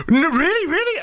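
high, excited disbelief; amazed clerk. Retold or imitated. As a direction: squeal it like a star-struck fan. Star-struck petrol station clerk.

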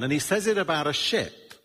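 ordinary delivery

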